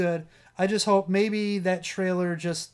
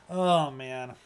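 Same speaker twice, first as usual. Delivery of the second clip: usual speaking voice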